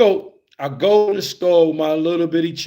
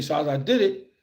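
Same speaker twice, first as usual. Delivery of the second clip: usual speaking voice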